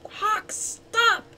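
high, urgent shouted command. A narrator's reading. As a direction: shout to halt a sword strike. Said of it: "shouted Falcon".

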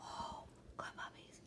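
whispered praise; hushed pet praise. Real, not acted. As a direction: breathe it softly to the puppies. Praising dogs softly.

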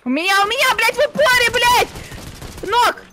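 yelling high and loud in the heat of a fight; excited shout. Real, not acted. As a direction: shout the kill callout.

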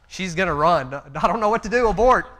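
hammed-up alarm as the plan falls apart; inner panic. Father's inner voice watching daughter, mock loud panic. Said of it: "I'm thinking in my head".

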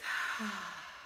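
long breathy release sigh. Shown as a demonstration. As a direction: let the breath out in a big sigh. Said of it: "make a sigh when you release".

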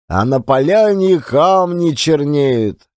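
plain reading voice

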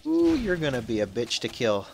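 ordinary talk

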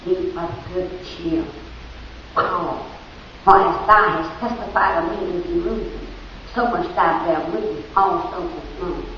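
voice of the Lord. Acted. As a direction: speak as the Lord, hushed and grave. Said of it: "the Lord stood by him and said".

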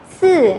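"sư" as ts with a strong puff, loud, falling; aspirated C. Shown as a demonstration. Teacher rereads second sound for students, "sư" strongly aspirated. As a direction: blast air out on "sư". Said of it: "cô đọc lại ba âm này".

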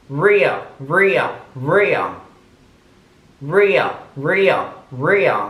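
real said again and again, crisp and clear; repeated real. Shown as a demonstration. Teacher drilling real for students, clear. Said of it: "반복하겠습니다".

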